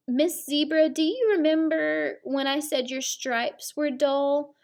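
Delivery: plain reading voice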